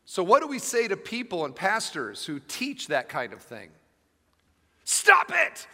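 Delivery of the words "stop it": much higher and louder sudden shout; mock therapist yell from the pulpit; shouted punchline. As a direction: hurl it at the false teachers. Preacher quotes the punchline at pastors.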